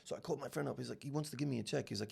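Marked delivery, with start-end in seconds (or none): none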